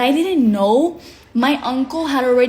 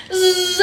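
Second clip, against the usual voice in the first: high buzzing zip sound; imitated zooming noise; 8 semitones higher, 3 dB louder than usual. Showing herself sliding before her uncle hit, buzzing zoom. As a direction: buzz like something whizzing along.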